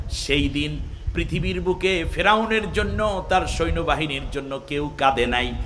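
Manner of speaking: slow, low, half-chanted preaching; lilting, drawn-out preacher's delivery; melodic sermon translation. Acted. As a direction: intone it softly, stretching the phrase endings. Preacher renders verse melodically.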